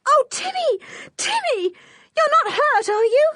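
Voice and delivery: very high, loud desperate cry; frantic fear. Acted. George crying to Timmy after the gunshot, frantic. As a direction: fear the dog is shot.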